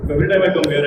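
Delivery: ordinary talk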